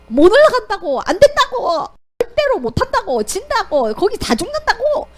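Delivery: stamp your feet and refuse; mock crowd protest; whining complaint. People complaining loudly.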